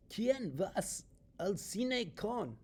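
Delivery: "¿Quién vas al cine con?" said high and slow in a silly off voice, "con" left hanging; mock wrong Spanish. Shown as a demonstration. Teacher mocking the wrong Spanish word order for students. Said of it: "it sounds really, really bizarre".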